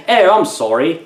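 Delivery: hammed-up, terrible fake British voice; bad British accent. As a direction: attempt a British accent and miss badly. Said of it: "even a worse British accent".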